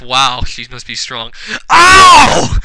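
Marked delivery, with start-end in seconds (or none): 1.7-2.6 loud, high drawn-out "ow"; shout in pain. Acted. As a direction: yelp in hammed-up pain. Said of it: "hand shout in pain".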